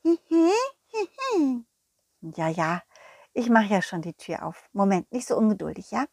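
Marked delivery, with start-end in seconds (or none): 0.0-1.7 high tittering giggle; the dog giggling; high squeaky giggle; giggly little dog voice. Acted. Balli giggles.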